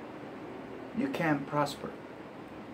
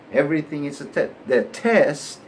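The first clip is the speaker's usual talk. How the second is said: ordinary talk, nothing marked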